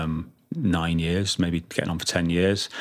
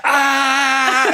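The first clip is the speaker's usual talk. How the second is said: high, loud imitated yell; excited shout; 5 dB louder than usual. Drummer shouting as he plays like this, imitated excited yell. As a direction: mimic an overexcited drummer's cry. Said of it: "he shouts, as, and he's so excited".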